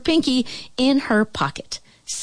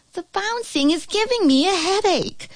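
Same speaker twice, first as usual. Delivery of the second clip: Aunt Pearl; 13 semitones higher than usual. A narrator's reading. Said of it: "said Aunt Pearl".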